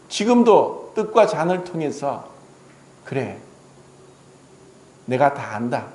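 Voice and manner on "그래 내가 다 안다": low and soft, gently reassuring; Jesus forgiving a penitent believer, low and soft. Acted.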